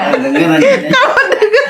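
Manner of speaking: breaking into high-pitched laughter; laughing. Real, not acted. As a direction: let the words collapse into a laugh. Amused at her own retold story. (sambil tertawa geli)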